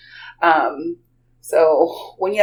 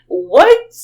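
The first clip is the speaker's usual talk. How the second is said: loud, high rising question; incredulous outburst; 5 semitones higher, 8 dB louder than usual. Retold or imitated. Aunt seeing the lady keep up, loudly incredulous. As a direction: burst out in mock disbelief. Stunned aunt in retold story.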